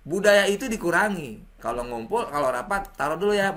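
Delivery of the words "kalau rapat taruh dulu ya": normal talking voice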